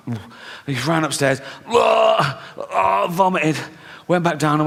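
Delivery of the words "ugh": a quick retching "ugh"; retching groan. Retold or imitated. Cousin being sick upstairs, hammed-up retching groan. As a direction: gag like he's being sick.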